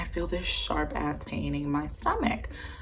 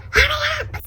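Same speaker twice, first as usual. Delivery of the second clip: much higher, shrill urging; desperate squeal; 6 dB louder than usual. Retold or imitated. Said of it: "I'm like".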